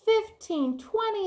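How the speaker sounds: high cheering count by fives. Acted. Fives club cheering as they count, high excited. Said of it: "they cheered".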